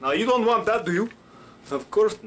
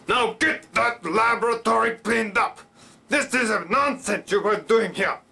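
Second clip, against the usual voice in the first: gruff, raised, scolding voice; 5 semitones higher than usual. Shown as a demonstration. Boss ordering the laboratory cleaned up, gruff. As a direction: order them about gruffly, pitched up and forceful. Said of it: "to do a gruff voice".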